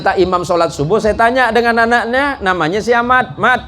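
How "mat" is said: ordinary delivery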